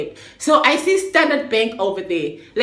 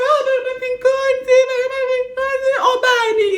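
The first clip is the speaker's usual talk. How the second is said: high, loud tearful pleading; tearful high denial; 4 dB louder than usual. Retold or imitated. To the worried ladies, she sobs it's nothing in a high tearful voice. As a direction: brush it off while sobbing.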